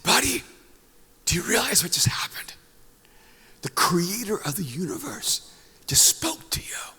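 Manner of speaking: hushed, breathless whisper of wonder; retelling an awed whisper to his son. Retold or imitated. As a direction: whisper it like a sacred secret. Father awed by son hearing God.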